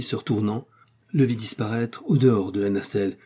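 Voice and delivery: ordinary delivery, nothing marked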